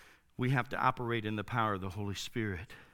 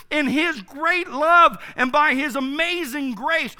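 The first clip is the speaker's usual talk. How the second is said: loud, high, emphatic sermon delivery; fervent pulpit proclamation; full-force preaching; 13 semitones higher, 13 dB louder than usual. Real, not acted. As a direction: proclaim it to the congregation, punching key words.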